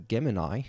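"Gemini" ending in a long "eye"; mispronounced Gemini. Shown as a demonstration. Video host admitting an earlier pronunciation, "Gemini" said with an "eye" ending. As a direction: say "Gemini" with the last syllable rhyming with "sky". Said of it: "I was pronouncing Gemini ... as Gemini".

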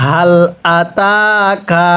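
"আতাকা" drawn out very slowly for repeating; slow recitation model. Shown as a demonstration. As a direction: model each word slowly for the class to echo. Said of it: "আপনি আমার সাথে মুখে মুখে পড়বেন". (ধীরে টেনে শিখিয়ে পড়া)

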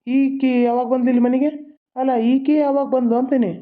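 who let her in, outraged; indignant surprise. Acted. Brother demanding when his sister came, indignant.

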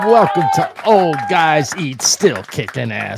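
loud, high, rousing welcome; hyped show intro. Real, not acted. Host greeting viewers at the top of the show, hyped. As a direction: belt out the show opening.

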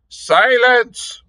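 high furious roar. Acted. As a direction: roar it out in fury. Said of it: "roared Tal Hodges".

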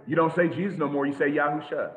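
normal talking voice

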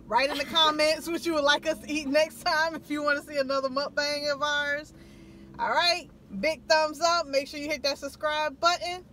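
loud, upbeat sign-off; vlog outro. Acted. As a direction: hype up the goodbye to viewers.

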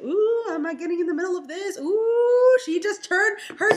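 husband backing off, high and loud; mock alarmed husband. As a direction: play the nervous husband.

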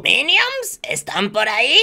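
failed Gru impression that fades at once. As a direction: try out a cartoon villain calling for his minions. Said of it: "me puede salir la voz de Gru".

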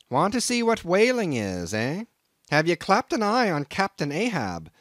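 crusty Nantucket captain sizing up a greenhorn; gruff old sea captain. Acted. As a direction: bristle at the stranger like a crusty old skipper. Captain mocks Ishmael's answer.

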